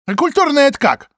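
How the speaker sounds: high, loud feigned innocence; loud mock question. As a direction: ask loudly to get a laugh. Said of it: "громко осведомилась Паша". (громкий насмешливый вопрос)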